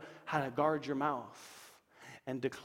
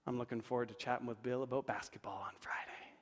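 soft, unvoiced whisper, lower; whispered affirmation; 4 semitones lower, 10 dB quieter than usual. Shown as a demonstration. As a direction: murmur it as a private resolve. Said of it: "Instead, say this".

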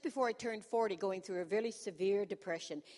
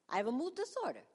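high, indignant Italian-style outburst; mock Italian accent; 8 semitones higher, faster than usual. Patient reacting to the psychiatrist's diagnosis, mock Italian accent. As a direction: exclaim it in a hammed-up Italian accent. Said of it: "Why am I talking like this?".

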